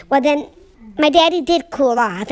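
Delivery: ordinary talk, nothing marked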